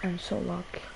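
soft whispered delight; whispered gratitude. Real, not acted. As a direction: breathe it out in disbelief. Repeats joy whispered.

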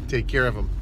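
mean it like a mob threat, low and knowing; ominous "take care of him". Shown as a demonstration.

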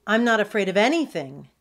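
loud, defiant puppy bluster; lightly voiced puppy, speaking up loudly; barked retort. A narrator's reading. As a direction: bark back, a touch of bravado. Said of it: "Wally barked".

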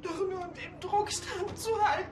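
unter Tränen, hoch und verzweifelt; crying. Acted. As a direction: bricht weinend zusammen. Mother breaks down about son. (die Mutter schluchzt verzweifelt über den Vater)

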